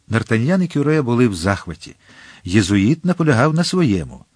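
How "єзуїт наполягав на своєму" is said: usual speaking voice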